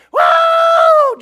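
very high, loud, sustained scream; storyteller's full-voiced imitation scream; hollered whoop. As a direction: wail it long like a man being mauled. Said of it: "John's a hollering".

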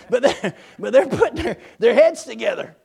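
chuckling through the words; amused, laughing aside. Real, not acted. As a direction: laugh while you tell it. Laughs at his own joke.

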